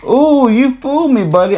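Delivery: surprised. Real, not acted. Surprised by son's restraint.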